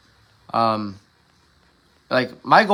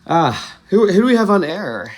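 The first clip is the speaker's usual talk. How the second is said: raised, louder radio-host query; half-hearted mock radio host; Terry Gross voice; 5 semitones higher, 5 dB louder than usual. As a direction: play at hosting a call-in show. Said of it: "I gotta do my Terry Gross voice".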